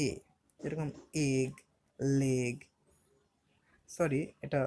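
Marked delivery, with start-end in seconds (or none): 1.3-2.6 এগ and লেগ each with an "e" vowel; E pronounced "e". Shown as a demonstration. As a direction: model এগ, then লেগ, as separate words with "e". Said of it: "ই এর উচ্চারণ কেমন হয়". (এগ, লেগ-এ E-এর উচ্চারণ "এ")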